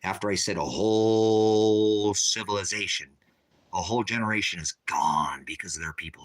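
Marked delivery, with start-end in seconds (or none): none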